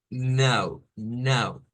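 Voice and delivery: "now" with the A sent through the nose; loud, nasalized "now", each apart; nasal A. Shown as a demonstration. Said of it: "Esa A nasal".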